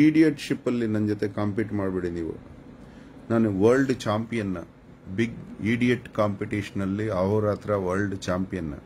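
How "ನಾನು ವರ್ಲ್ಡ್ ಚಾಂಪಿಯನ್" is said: normal talking voice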